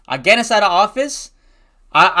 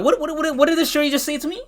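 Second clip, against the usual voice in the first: high-pitched, stammering question; stunned disbelief; 9 semitones higher than usual. Retold or imitated. Student asking himself what principal just said, stunned. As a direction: repeat it in a high, incredulous rush.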